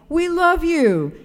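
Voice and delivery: slow, high called greeting; calling out. Retold or imitated. Attendant calling to a passing driver, slow high call. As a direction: stretch it out across the forecourt, pitch well up.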